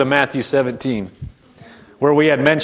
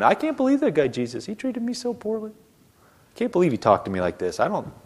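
mock whiny complaint; 5 semitones higher, 6 dB quieter than usual. Mocking whiny offended person.

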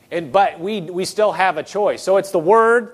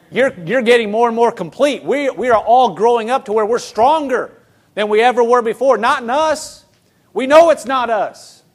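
higher and louder, building preacher fervour; rising preaching; 5 semitones higher, 4 dB louder than usual. Acted. Pastor rallies the congregation, building preaching fervour. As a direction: lift the congregation as you climb.

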